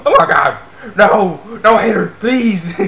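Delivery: high, loud hammed-up pleading; mock terror. Reviewer imitates a girl scared by a threat, mock-terrified plea. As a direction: plead in exaggerated fright, drawing out the last words. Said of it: "that scared the crap out of her".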